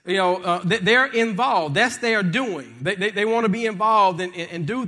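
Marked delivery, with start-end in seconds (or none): none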